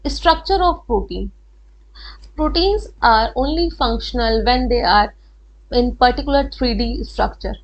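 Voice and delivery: very slow, word by word, for note-taking; slow dictation. Shown as a demonstration. Teacher to students, dictating the definition slowly for notes. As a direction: spell it out so students can write it down.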